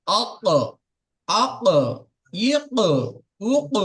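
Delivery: আক আক ইক উক, each stopped hard at the back of the mouth; qaf vowel drill. Shown as a demonstration. Teacher drilling qaf with each vowel for learners. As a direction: tap through আক, ইক, উক crisply. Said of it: "এভাবে আপনি পড়তে পারবেন".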